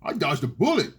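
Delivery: confused guy. Retold or imitated. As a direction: play the clueless guy who doesn't get it. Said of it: "you're like".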